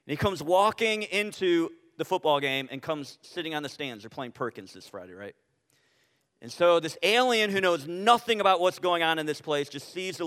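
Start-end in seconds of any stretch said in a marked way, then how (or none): none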